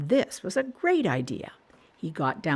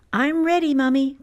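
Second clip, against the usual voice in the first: put-on high, louder child voice; little Caillou, higher and louder; eager little boy; 8 semitones higher, 6 dB louder than usual. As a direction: sound like an excited small boy.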